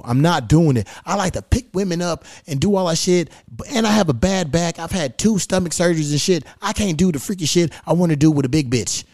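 ordinary talk

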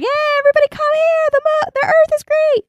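very high cartoon yell; high silly yelling; mock yell at the moon. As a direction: shout to the moon in a squeaky put-on voice. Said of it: "He yells at the moon".

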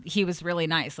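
normal talking voice

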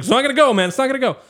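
high-pitched imitated protest; insistent attendant. Attendant objects to forcing cart out.

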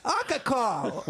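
put-on high whiny toddler demanding his booze; baby alcoholic voice. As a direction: play a tiny drunk baby wanting a drink. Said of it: "I just want him to say that".

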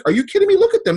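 excited disbelief. Retold or imitated. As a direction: blurt it out like the answer is obvious. Friend Joe reacting after a speech.

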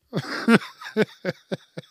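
high, loud real cackle; bursting laugh. Real, not acted. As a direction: burst out laughing mid-thought. Laughing at this guy.

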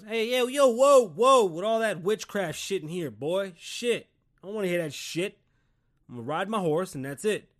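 loud, high-pitched protest, cutting him off; stubborn dad refusing newfangled nonsense; indignant old Pops. Acted. Father hearing about engines from his kid, indignant refusal. Acted father rejecting talk of cars.

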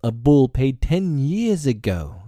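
the pack snarling at Bagheera's offer. Acted. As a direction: snap it back scornfully as the wolves. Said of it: "the pack snarled".

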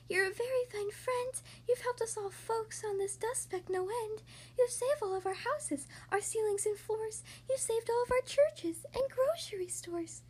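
high, small speck-dweller voice; tiny faint voice. A narrator's reading. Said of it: "The voice was so faint".

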